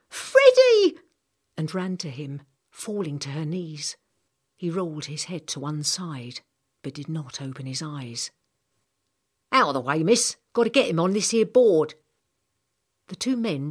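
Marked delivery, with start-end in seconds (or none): 0.1-1.0 high, loud anguished cry; crying out a name. Acted. Girl seeing her hurt friend, anguished cry. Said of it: "she cried". 9.5-11.9 loud, rough command; gruff working man. Acted. Stretcher man to the girl, loud and brusque.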